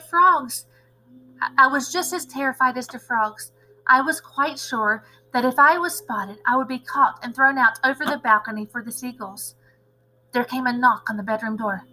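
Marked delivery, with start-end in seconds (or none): none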